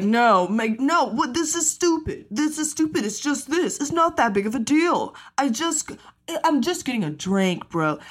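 whiny defiant cutter. Retold or imitated. Mocking the defiant line-cutter.

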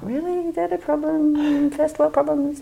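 high mock disbelief; sarcastic high-pitched first world problems; incredulous. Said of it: "and you're like".

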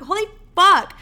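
high, loud alarmed swearing; mock panic. Reacting to "you're gonna die", mock panic. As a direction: burst out in dramatized panic. Acts out panic at intrusive thought.

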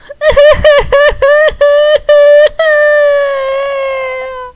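long, high, loud acted bawling; hammed-up sobbing wail; wailing cry. Candy bawling after fighting Tom, wailing cry. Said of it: "Candy's crying".

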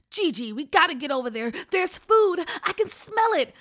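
loud, rising to a high pitch; excited. A narrator's reading. Hungry brother smelling food calls his sister, excited. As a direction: build excitement with each phrase, loud. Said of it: "Hala said excitedly".

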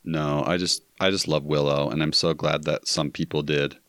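ordinary delivery

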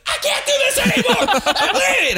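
very high frantic yelling; panicked shriek. Retold or imitated. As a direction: screech in drunk terror. Imitating Becca, very high shout.

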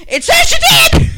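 high, loud retold shout; exasperated shouting. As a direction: shout back in exasperated insistence. Retold argument over 911 call.

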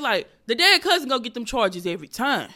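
belted the second time, full stage voice; loud punchline. Retold or imitated. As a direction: sell the joke out loud to the room. Retelling the joke on stage.

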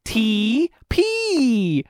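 T P in a sing-song Swedish accent. Shown as a demonstration. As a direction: say T P like a Swede. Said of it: "how to say T P in a Swedish accent".

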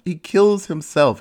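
normal talking voice

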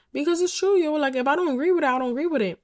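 high and loud, insisting she was right; emphatic insistence. Real, not acted. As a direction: drive it home, heated and emphatic.

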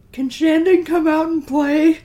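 put-on high sing-song kid voice asking; neighborhood kids. Kids at the door asking for Shandon, high childish imitation. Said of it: "and they said".

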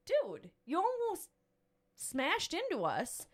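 high, slow, disbelieving dude; incredulous scolding. Retold or imitated. Mom glaring at the careless driver, slow incredulous scold. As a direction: stretch it out in disbelief. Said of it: "I just, like, look at him".

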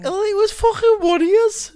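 very high, loud Scottish outburst; hammed-up Scottish shout; Scottish accent. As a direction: yell it as a screeching Scottish sound bite. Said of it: "best Scottish, like, sound bites".

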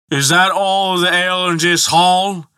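high-pitched, slurred demand. A narrator's reading. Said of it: "demanded the giant".

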